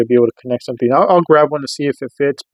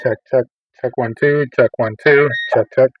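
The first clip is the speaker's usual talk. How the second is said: muffled radio check. Real, not acted. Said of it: "sounds really amplified, just really muffled".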